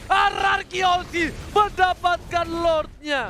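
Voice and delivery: slow drawn-out hype shout; excited shout. Real, not acted. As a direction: yell the big steal. Caster calls Lord steal.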